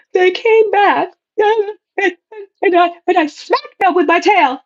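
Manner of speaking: high-pitched hammed-up wail; frantic client. Client reports smacking returned ex with tail, mock hysterical. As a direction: imitate a worked-up client blurting out a confession.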